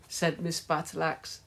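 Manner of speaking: ordinary delivery, nothing marked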